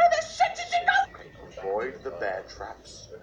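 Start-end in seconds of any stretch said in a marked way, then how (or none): 0.1-1.0 high startled yelp; startled yell. Real, not acted. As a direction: blurt it out in shock. Startled by granny.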